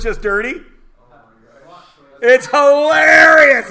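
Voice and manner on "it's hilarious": loud, slow, delighted outburst; excited exclamation. Real, not acted. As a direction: sell how funny the ad is. Laughing delight at the joke.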